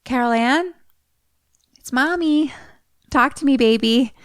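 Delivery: high, pleading call into the static; mother calling her child. Retold or imitated. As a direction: coax a lost daughter to answer. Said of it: "she's like".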